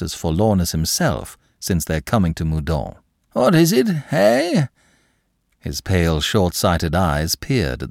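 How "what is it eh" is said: put-on high, startled old lord. A narrator's reading. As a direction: jump at it, startled. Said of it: "startled by this exuberant invasion".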